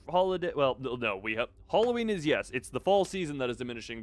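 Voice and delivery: ordinary talk, nothing marked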